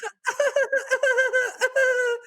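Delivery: very high strained giggle; nervous laugh. Shown as a demonstration. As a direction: squeak out an uneasy laugh. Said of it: "or like".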